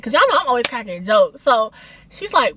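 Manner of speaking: ordinary delivery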